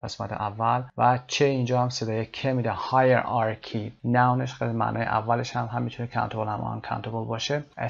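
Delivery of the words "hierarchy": hierarchy said very slowly, first part HI stressed; stress on first syllable. Shown as a demonstration. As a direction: stretch out hierarchy and lean on its start. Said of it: "استرسش روی قسمت اول هست". (hierarchy آهسته با تکیه روی بخش اول)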